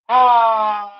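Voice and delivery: a loud, high strained cry of effort. Real, not acted. Straining as she rolls over.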